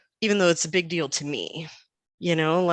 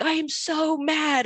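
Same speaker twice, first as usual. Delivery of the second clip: high, slow mock declaration; imitated mad child; 9 semitones higher than usual. Mom quoting her five-year-old son, mock mad. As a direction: announce it like an indignant little kid. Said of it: "The first time he ever said".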